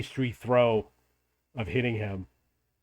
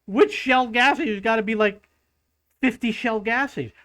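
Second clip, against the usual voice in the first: higher, incredulous mock complaint; exasperated; 7 semitones higher than usual. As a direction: act baffled by the vague directions. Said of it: "I was like".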